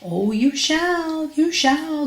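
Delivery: high, slow goblin singsong; grinning goblin. Acted. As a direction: promise it teasingly in a high grinning voice. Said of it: "grinned the goblin".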